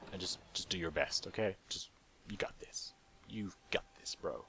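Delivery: soft, whispered pep talk; hushed encouragement. Pretend. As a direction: quietly hype up your buddy. Encouraging companion before stealing.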